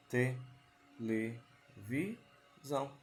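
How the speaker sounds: "Televisão" said slowly as te-le-vi-são; slow teacher count of "Televisão"; syllable by syllable. Shown as a demonstration. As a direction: break the word into its four beats for the class. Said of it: "Foram quantas?".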